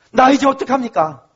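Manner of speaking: usual speaking voice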